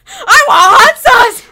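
slow whiny yell, laughing; mock whiny shout. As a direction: imitate a loud whining demand. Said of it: "And he's like".

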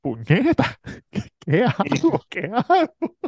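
hammed-up flustered girlfriend; mock panic. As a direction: squeak it like a trapped girl.

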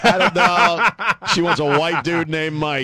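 laughing through the words; cracking up while talking. Real, not acted. Host laughs at cohost's joke.

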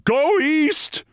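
high bawled cry; bawling. A narrator's reading. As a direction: bawl it at full tilt.

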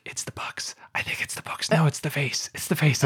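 urgent whisper, imitating kids; whispered guessing. Dad imitating daughters guessing at the show, whispered. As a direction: whisper rival guesses, rising out of the whisper.

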